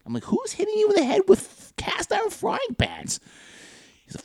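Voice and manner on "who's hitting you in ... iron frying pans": high-pitched, baffled disbelief; mock-bewildered, voice shooting up high; incredulous question. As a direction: question him as if it's utterly absurd. Incredulous reply to coworker's claim.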